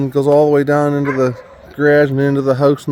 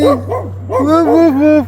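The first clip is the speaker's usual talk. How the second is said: playful woof; 13 semitones higher, 4 dB louder, much slower than usual. Pretend. Barking back at dog.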